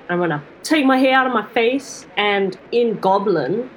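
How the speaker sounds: normal talking voice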